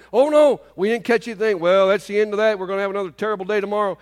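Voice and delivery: hammed-up gloom over empty nets; mock despairing fishermen. Fishermen griping to each other about no catch, mock despair.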